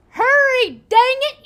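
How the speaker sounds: high, loud urgent call; shouting. Acted. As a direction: yell it across the water. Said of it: "you call out to her".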